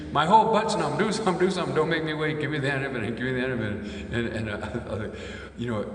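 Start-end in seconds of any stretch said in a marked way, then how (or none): 0.1-4.1 high, loud panicked yelling that fades out; mock panic, high and loud, then tiring; panicked screaming. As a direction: scream like a man who thinks he's dying. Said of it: "I can hear this guy screaming".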